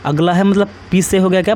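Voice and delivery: ordinary talk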